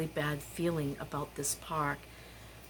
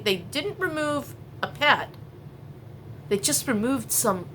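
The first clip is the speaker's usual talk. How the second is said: usual speaking voice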